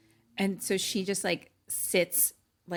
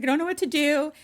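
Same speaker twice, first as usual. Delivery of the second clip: high, loud imitation of panic; freaking out; 9 semitones higher, 7 dB louder, faster than usual. Leah tells the operator her mom died, freaking out. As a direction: act out a panicked kid on the phone. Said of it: "she's, like, freaking out".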